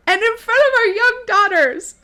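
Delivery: high, loud hammed-up indignation; mock outrage. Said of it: "And she says again".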